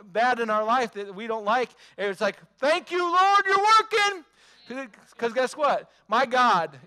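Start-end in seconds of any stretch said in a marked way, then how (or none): 2.6-4.2 high, loud thankful cry; high loud praise shout; joyful praise. Pretend. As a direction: burst out thanking the Lord. Mock grateful prayer.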